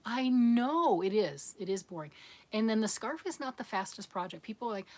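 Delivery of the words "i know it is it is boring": loud, drawn-out "I KNOW"; slow, louder, wholehearted concession; emphatic sympathetic agreement. Acted. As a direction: stretch KNOW in mock commiseration. Conceding to an imagined complaining viewer.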